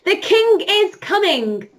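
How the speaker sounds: high, loud warning call; announcing the king. Pretend. Teacher warns children the king is coming, urgent call. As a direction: sound the alarm that the king is here. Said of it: "When I say".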